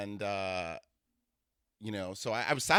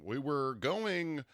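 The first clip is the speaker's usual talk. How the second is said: very slow, higher announcer imitation; Sesame Street announcer; 4 semitones higher, much slower than usual. Showing how her date literally speaks, slow announcer impression. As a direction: draw it out like a kids' TV announcer. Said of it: "like, an announcer on Sesame Street".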